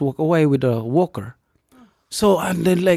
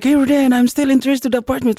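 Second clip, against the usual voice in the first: much higher, louder and fast, a bright eager call; eager caller; 12 semitones higher, 4 dB louder, faster than usual. Retold or imitated. Retelling her morning call, higher, louder and faster. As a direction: rush it out like good news. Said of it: "she called in the morning, like".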